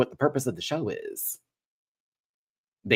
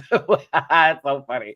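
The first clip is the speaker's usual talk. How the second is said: ordinary delivery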